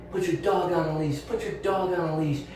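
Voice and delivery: mock yell. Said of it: "she starts yelling".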